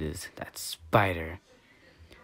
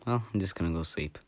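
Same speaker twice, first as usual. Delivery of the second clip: usual speaking voice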